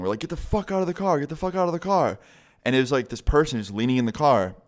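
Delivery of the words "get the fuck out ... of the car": high, half-hearted yell at a car thief; mock shout to scare them off; yelling get out of the car at the thief; retold yelling. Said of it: "But we're yelling".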